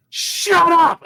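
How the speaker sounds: high, loud mock yell; shouted. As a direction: snap it at your son. Mom snapping at son.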